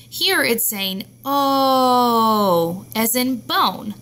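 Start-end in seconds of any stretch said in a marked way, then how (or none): 0.1-2.9 oh stretched long and very slow; drawn-out oh. Shown as a demonstration. Teacher to class, stretching the long oh sound. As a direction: hold the oh so children hear the long vowel. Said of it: "this one letter saying a different sound".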